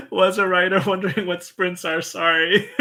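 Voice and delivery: loud, high, laugh-filled apology. Real, not acted. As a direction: crack up while reading it out. Breaks up reading chat comment.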